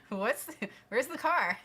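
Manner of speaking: high, loud words through laughter; laughing disbelief. Real, not acted. Calling her husband from the garage, laughing high. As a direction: giggle out the question to your husband.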